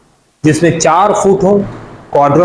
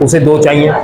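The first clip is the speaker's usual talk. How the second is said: ordinary talk, nothing marked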